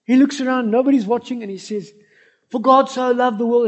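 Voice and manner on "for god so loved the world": mock shout. Man shouting into a manhole.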